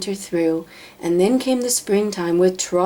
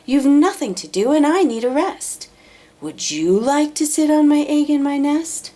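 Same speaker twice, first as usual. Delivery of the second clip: higher, louder coaxing bird voice; sweet-talking bird; 8 semitones higher, 4 dB louder than usual. Acted. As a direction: wheedle him with a sugary smile. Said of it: "called the lazy bird, smiling her best".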